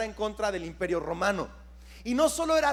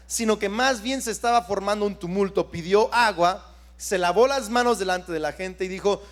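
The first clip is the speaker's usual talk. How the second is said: ordinary delivery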